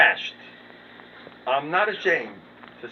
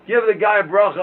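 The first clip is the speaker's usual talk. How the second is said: urgent plea; 8 semitones higher, 11 dB louder than usual. Retold or imitated.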